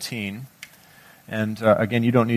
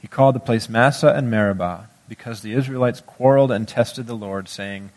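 scripture reading; 3 semitones lower than usual. Acted. Preacher reads Exodus seventeen aloud.

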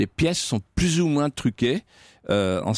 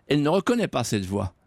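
ordinary delivery

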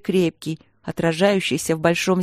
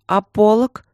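loud, higher startled question; alarmed exclamation; 4 semitones higher, 6 dB louder, slower than usual. A narrator's reading. Sister noticing the bride's missing veil, exclaiming. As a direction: call out the sudden oversight. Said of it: "воскликнула внимательная Василина".

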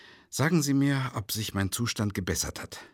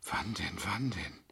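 horrified whisper; 10 dB quieter than usual. Acted. Horrified realization.

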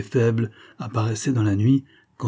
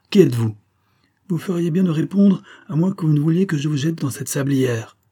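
raised, threatening demand; higher voice, warn him off forcefully; crying out; 7 semitones higher, 4 dB louder than usual. A narrator's reading. Major threatens the silent stranger, crying out. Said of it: "s'écria-t-il en jetant son cigare".

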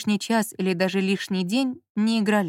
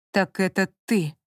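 bewildered drawl. Acted. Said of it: "протянул он странным, растерянным голосом".

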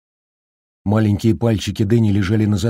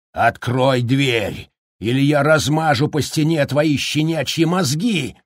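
berserk rage; 7 semitones higher than usual. A narrator's reading. Jack threatening his son.

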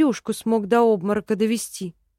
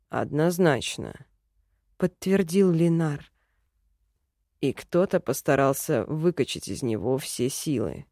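elf man voice; 6 semitones lower than usual. A narrator's reading. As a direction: state it low and sure. Elf character voice. (низкий уверенный голос эльфа)

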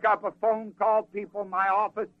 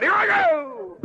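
excited cry; 14 semitones higher, 5 dB louder, slower than usual. Acted. Woman mounting ass in hurry.

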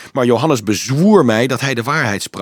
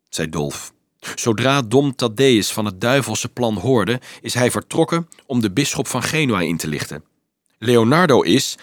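ordinary delivery, nothing marked

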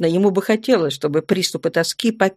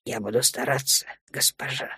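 mock-solemn, lower and much softer; bow and promise with hammed-up gravity; playfully grave vow to a stern girl; exaggeratedly serious; 6 semitones lower, 10 dB quieter than usual. A narrator's reading. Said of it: "преувеличенно серьёзно проговорил".

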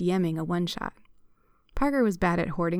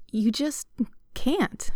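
higher-pitched, halting protest; arguing; 6 semitones higher, much slower than usual. A narrator's reading. Alec objects to Parker's deadly encounter, arguing. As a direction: argue back, mildly exasperated. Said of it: "Alec argued".

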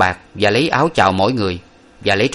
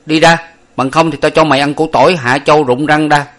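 raised, higher cheeky threat; threatening servant boy; 6 semitones higher, 5 dB louder than usual. A narrator's reading. Servant boy threatens to feed him garlic, loud and high. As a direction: bark a mock threat up high. Lục Tặc threatening someone.

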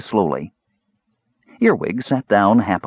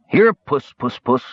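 higher, sing-song kitty call; coaxing the cat; 4 semitones higher, slower than usual. A narrator's reading. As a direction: coax the cat closer with a lilting call.

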